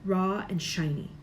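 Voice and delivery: plain reading voice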